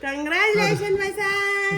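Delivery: loud, high cry of congratulation; elated, ringing shout; joyful congratulations. Real, not acted. Mother hears son's good news.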